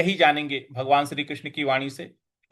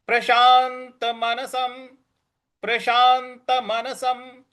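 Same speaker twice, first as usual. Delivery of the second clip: Prashant with the half न pressed, slow and higher; stress on the half न; 8 semitones higher, much slower than usual. Shown as a demonstration. Teacher showing students the stress on the half न. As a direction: lean on the न in Prashant. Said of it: "उस पर हमको बल लगाना है".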